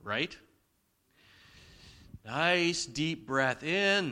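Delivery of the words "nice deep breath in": breathing-in demo. Shown as a demonstration. Preacher guiding parents through a calming breath in, drawn out. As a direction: draw out "in" like a long inhale. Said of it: "taking a breath".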